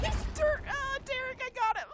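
high laughing voice. Real, not acted. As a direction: squeal through laughter. Laughing gamer.

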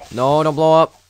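loud desperate plea; panicked shout. Real, not acted. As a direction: yell at the creeper. Creeper about to explode.

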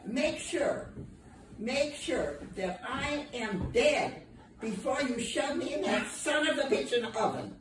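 usual speaking voice

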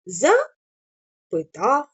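very slow, drawn out «запитав»; syllable by syllable. Shown as a demonstration. Teacher stretching the word for syllable division. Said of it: "складами можемо перенести".

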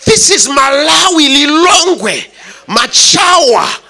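exasperated high protest, building to a near shout; incredulous outburst. Retold or imitated. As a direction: act out a husband's rising disbelief, pitched well up. Acted Joseph's incredulous outburst at Mary.

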